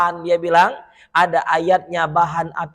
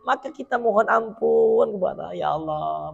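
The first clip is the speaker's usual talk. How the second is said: usual speaking voice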